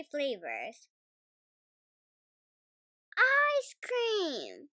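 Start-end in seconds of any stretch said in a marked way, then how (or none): 3.2-4.7 very high, loud cheer; a child thrilled at a treat. A narrator's reading. Peppa cheers at the ice cream cart, very high and loud. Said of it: "cheers Peppa".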